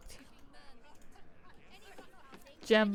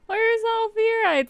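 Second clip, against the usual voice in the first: high excited question; 12 semitones higher, 5 dB louder than usual. Shown as a demonstration. Repeats question after laughing.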